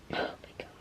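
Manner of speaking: barely voiced, hushed; breathless whisper. Real, not acted. Reader overwhelmed by the book, whispered under breath. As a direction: let it slip out under your breath.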